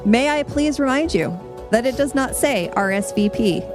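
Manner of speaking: ordinary delivery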